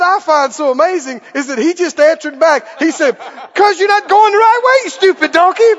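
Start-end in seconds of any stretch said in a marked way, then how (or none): 3.5-5.7 very high, loud comic scolding; play-acted exasperated outburst; mock angry retort. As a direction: yell back at the donkey, hammed-up. Acted quarrel, Balaam answers back.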